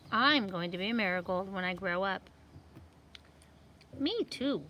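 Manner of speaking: lively seed voices for a picture book; talking seed characters. Acted. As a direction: voice the little seeds for kids. Seeds talk in picture book.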